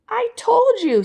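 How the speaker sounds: high, loud, exasperated; exasperated squeal. Acted. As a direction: blurt it high in frustration. Homer exasperated.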